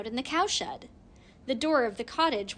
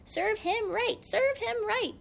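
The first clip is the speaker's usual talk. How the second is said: shrieking hens; 11 semitones higher than usual. A narrator's reading. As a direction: jeer like clucking hens. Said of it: "shrieked the hens".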